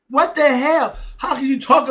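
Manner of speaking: sudden loud high yell of disbelief; outraged shout. Real, not acted. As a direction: blurt it out at full volume, appalled. Outraged outburst at hypocrisy.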